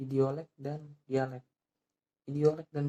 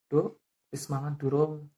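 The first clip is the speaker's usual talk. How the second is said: Javanese dialect; 7 dB louder than usual. Shown as a demonstration. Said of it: "contohnya adalah bahasa Jawa".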